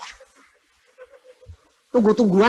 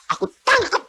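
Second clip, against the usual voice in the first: mock fierce threats against the demon; fierce preacher; 8 semitones higher than usual. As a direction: ham up the overly fierce deliverance minister. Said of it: "biasanya lebih galak ya".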